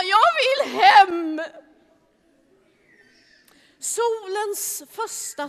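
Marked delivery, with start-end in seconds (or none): 0.0-1.4 slow, tearful whimper, a bit louder; whiny crying plea. Acted. John whining to go home in the boat, slow and crying. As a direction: whine it like a tired child close to tears. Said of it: "Johannes klagade och sa".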